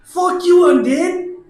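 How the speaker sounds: high, loud battle cry; hammed-up high-pitched challenge; defiant in-character shout. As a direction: yell defiance at the undead. Player defies skeleton in character.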